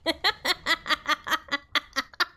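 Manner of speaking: high, rapid laugh; burst of laughter. Real, not acted. Player delighted by the game, high laughter. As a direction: laugh in a long, high run.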